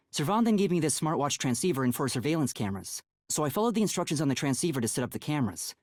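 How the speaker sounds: rapid, businesslike summary of events; hurried retelling of the story so far; fast recap. Acted. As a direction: rattle off the backstory quickly.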